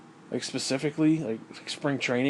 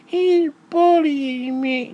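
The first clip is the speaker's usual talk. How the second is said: high, slow, whiny mock child voice; mock a crybaby tattling; sneering impression of a whining child; whiny mocking kid; 13 semitones higher, 5 dB louder, slower than usual.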